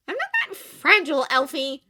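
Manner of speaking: high, loud, sharp retort; snapped. A narrator's reading. Glinda snapping at Elphaba, high and loud. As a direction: snap back, stung. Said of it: "snapped Glinda".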